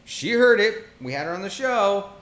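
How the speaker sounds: excited insisting. Real, not acted. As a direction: push it on him, laughing inside. Insisting teasingly to cohost.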